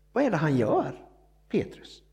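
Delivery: ordinary talk, nothing marked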